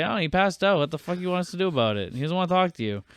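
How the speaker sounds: mock exasperated reply. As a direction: answer back fed up, half-acted.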